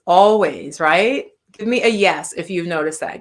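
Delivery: ordinary talk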